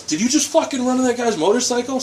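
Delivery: high, outraged shouted question; retold bystander's yell. Bystander confronting woman for the rider, high outraged yell. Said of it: "I can hear him yell".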